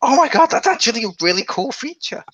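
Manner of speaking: mock-gushing delight; excited. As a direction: gush like a fan seeing it. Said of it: "I'm like".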